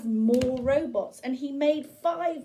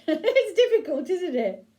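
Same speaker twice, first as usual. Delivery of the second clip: high laughing remark; 7 semitones higher, 6 dB louder than usual. Real, not acted. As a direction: laugh as you say it. Laughing at winking.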